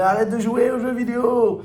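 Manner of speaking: put-on high, loud yell at a kid; yelling mother. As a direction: shout it like a fed-up mom. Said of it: "ma mère, elle hurlait".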